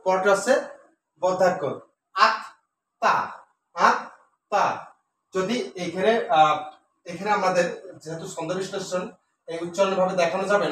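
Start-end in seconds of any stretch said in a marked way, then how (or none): 2.1-4.6 আত্মা slowly, with a pause between its two syllables; a teacher spacing out আত্মা; split into syllables. Shown as a demonstration. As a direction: break আত্মা into two separate beats. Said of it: "উচ্চারণ যেভাবে হয় এইভাবে".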